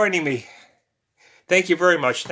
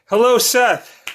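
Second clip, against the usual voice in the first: higher and louder hello; excited greeting; 6 semitones higher, 7 dB louder, slower than usual. Real, not acted. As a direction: brighten up to greet Seth. Greeting viewer joining stream.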